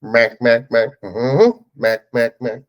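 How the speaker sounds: short, punchy repeated syllables with a hum; imitated movie bit. Guest imitating a movie character's short segment, playful impression. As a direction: chant it like a silly comic bit. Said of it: "his little short segment he had".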